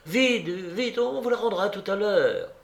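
high, pressing command; urgent insistence. Acted. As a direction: hurry him along impatiently. Sonia urges him to hurry. (insistance pressante)

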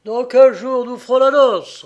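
loud, high, jubilant declaration; triumphant announcement. A narrator's reading. Father returns to tell André the date, triumphant. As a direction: proclaim the good news loudly.

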